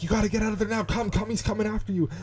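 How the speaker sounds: high, urgent mock yell without real volume; retold screaming. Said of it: "everyone's screaming".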